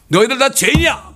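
loud, high scolding outburst; re-enacted preacher's indignant shout; sudden angry yell. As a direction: bellow at the hecklers. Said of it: "소리를 버럭 질렀죠".

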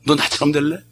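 usual speaking voice

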